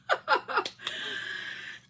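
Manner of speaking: high breathy laugh; airy high-pitched laughter. Real, not acted. Laughs at her lost voice.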